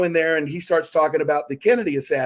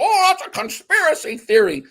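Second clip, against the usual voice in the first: mocking dismissal; 12 semitones higher, 4 dB louder than usual.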